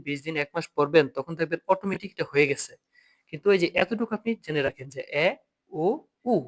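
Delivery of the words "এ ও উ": "এ, ও, উ" as pure vowels with no glide; normal umlaut pronunciation. Shown as a demonstration. Teacher telling students what to remember, normal glide-free pronunciation. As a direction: say "এ, ও, উ" simply, a pause between each. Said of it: "উচ্চারণগুলো একদম normally উচ্চারণ করবেন".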